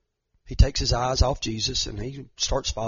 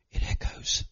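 whispered echo; 7 dB quieter than usual. Acted. Sermon, illustrating emptiness.

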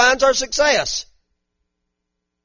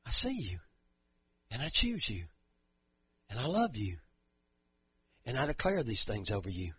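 much softer, sinking low; hushed voice of God; 10 semitones lower, 16 dB quieter than usual. Acted. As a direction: speak it tenderly, nearly a breath. God speaks, very softly.

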